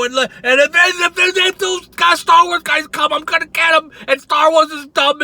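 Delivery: high, loud mock rant; mocking Walter. Keeps mocking rival's video.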